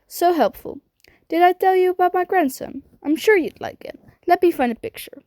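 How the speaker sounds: a hint of a fussy grandmother showing off her grandson; old lady voice. Old Miss Fig chatting to Sirius, lightly voiced old lady.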